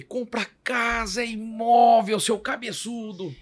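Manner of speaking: high, drawn-out mock scolding; imitated angry coach. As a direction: stretch it out like an exasperated coach telling off a player. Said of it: "chegava pro jogador e ficava pistola". (bronca imitada, aguda e arrastada)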